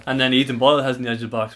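usual speaking voice

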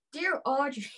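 letter opening in a put-on Irish accent; mock-Irish lilt. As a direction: read it as an Irish letter writer. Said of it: "Yeah, do an Irish one".

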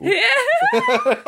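high, helpless laugh; laughing hard. Real, not acted. Listener cracking up at the impression, a high helpless laugh. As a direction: crack up at the joke. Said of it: "laughing at the impression".

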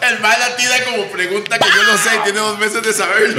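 high-pitched, amused protest through laughter; laughing tease. Real, not acted. Co-host teases friend over his TikTok trivia, laughing tease. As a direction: laugh while calling out your friend.

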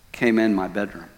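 ordinary delivery

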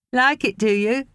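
put-on high, playful baby talk; nanny cooing while dancing with baby. A narrator's reading. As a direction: coo at her playfully.

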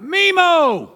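high, loud call across the room. Retold or imitated. Grandson calling his grandma from the platform, loud call. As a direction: shout the grandma name from a distance. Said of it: "shout out".

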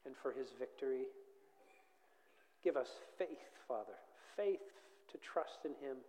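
low-key, measured prayer with long pauses. Real, not acted. Preacher thanking the Father for the King, quiet prayer. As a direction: pray softly, phrase by phrase.

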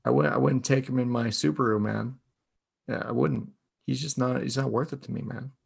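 soft, lazy stoner voice; tired and stoned. Pretend. Judging the quarterback with co-host, stoned voice. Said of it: "sound tired and stoned".

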